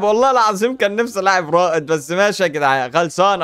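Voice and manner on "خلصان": ordinary talk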